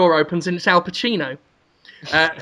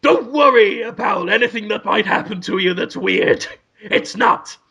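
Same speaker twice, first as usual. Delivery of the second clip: Al Pacino; 2 semitones higher than usual. Retold or imitated. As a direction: play it like Pacino reassuring the couple. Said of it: "Al Pacino's like".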